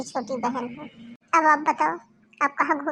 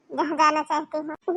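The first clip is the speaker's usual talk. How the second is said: ordinary talk, nothing marked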